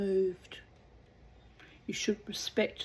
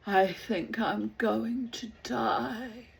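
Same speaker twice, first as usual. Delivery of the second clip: dying despair; 3 semitones higher, slower than usual. Acted. Diseased man despairs.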